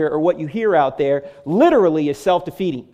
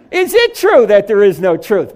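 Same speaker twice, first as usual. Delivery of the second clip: ordinary talk, nothing marked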